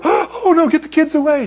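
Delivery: high, fussy mock alarm; alarmed apostles. Apostles shooing the children from Jesus, high mock alarm. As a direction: shoo the kids like a panicked apostle. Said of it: "the apostles and you and I go".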